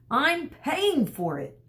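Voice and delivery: high, slow mock yell of an angry father; lightly hammed-up frustrated dad. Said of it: "Dad would yell".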